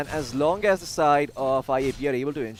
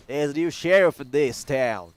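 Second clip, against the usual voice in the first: a mock Texan sheriff drawl, slowed and louder; hammed-up southern sheriff laying down the law; southern accent; 5 dB louder than usual. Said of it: "I did a super southern accent".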